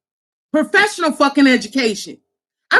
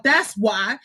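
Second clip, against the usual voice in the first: normal talking voice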